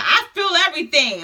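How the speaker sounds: mock alarm with a push of volume; freaking out. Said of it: "I start freaking out".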